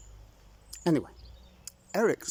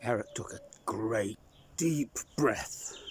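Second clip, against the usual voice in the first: usual speaking voice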